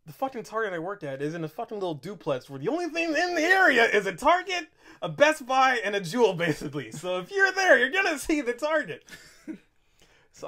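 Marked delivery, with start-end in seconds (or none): none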